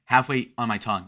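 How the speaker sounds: normal talking voice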